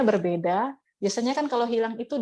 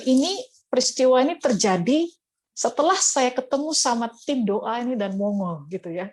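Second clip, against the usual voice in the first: normal talking voice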